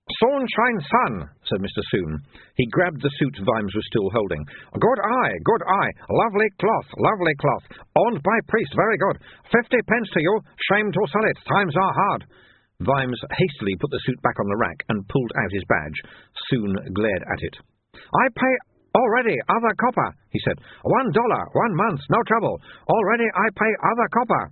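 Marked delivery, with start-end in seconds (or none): 0.1-1.3 high, slow, clipped-speech shopkeeper; a pushy little shopkeeper, pitched high; high-voiced haggling shopkeeper. Shopkeeper Soon's voice. 4.7-12.2 high-voiced shopkeeper making a sale; pushy shopkeeper. Soon selling the suit. 17.9-20.1 high and insistent; high shopkeeper insisting he already pays; stubborn shopkeeper. Acted. Soon refusing Vimes.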